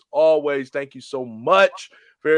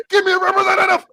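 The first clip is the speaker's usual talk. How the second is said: much higher, louder and fast; angry yell; 7 dB louder, faster than usual. Retold or imitated. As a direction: yell it at the phone. Caller yelling at phone system.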